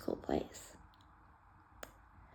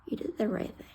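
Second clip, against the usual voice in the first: soft apology; 8 dB quieter than usual. Retold or imitated. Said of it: "and she says".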